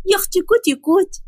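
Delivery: put-on high, playful baby-talk coo; high-pitched cutesy wife voice; cutesy coaxing. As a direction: coax a sulking husband with sweet teasing. Fatma cutely coaxing her husband.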